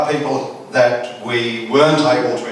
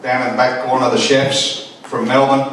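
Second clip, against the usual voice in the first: ordinary talk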